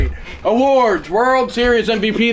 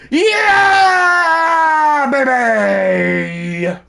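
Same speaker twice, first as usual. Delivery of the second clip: raised voice; 6 semitones higher, much slower than usual. Real, not acted. Streamer celebrating the win, yelling in real excitement. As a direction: let it rip in celebration. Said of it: "I don't know why I raised my voice like that".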